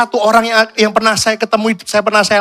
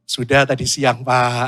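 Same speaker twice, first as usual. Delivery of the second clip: low, soft polite answer; trailing into a meek admission; keeping up appearances; 9 semitones lower, 5 dB quieter, slower than usual. Acted. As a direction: save face with a subdued reply. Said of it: "Cuman image".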